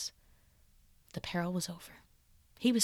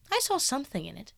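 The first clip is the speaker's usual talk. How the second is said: lightly voiced youth, a touch higher; young Dorian; 6 semitones higher than usual. Dorian guarding his secret from Basil, lightly higher.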